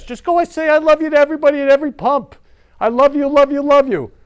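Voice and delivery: high, loud, gushing exhortation; excited urging. Real, not acted. Preacher urging the crowd at gas pumps, high and loud. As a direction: pump it up, nearly shouting the love. Urging love at every pump.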